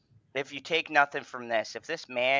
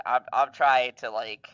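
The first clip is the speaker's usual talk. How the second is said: normal talking voice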